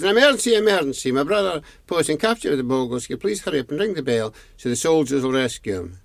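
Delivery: high, rapid gabbled plea; urgent alarm. Acted. Boy repeats alarm to old man.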